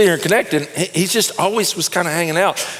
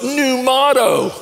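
proud declaration; 11 semitones higher, slower than usual. Retold or imitated. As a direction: announce it grandly, stretched out. Neighbor's new motto.

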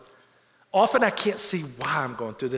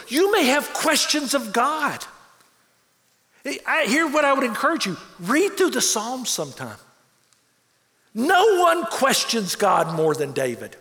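rising sermon intensity; 7 semitones higher, 3 dB louder than usual. Acted. Preacher urging doubters toward the Psalms, impassioned. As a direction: pitch climbing, pressing urgency.

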